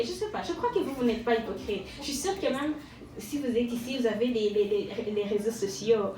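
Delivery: ordinary delivery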